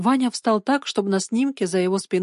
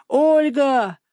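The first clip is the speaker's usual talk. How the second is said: higher, drawn-out call; raised chasing call; 6 semitones higher, 4 dB louder than usual. A narrator's reading. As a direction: shout her name after her as she gets away. Boy nearly catches up, calling her.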